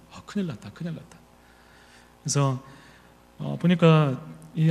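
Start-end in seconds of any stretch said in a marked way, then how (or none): 0.1-1.2 soft, panicky muttering to himself; panicked mutter. Retold or imitated. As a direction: mutter it under your breath in a panic. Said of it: "가슴이 막 뛰는 거예요". (작게 당황해서 중얼거리듯)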